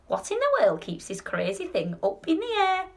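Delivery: croaked, high-pitched grandmother's question; croaky old grandma. A narrator's reading. Grandma demands to know what holds the lift up, croaking. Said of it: "croaked Grandma".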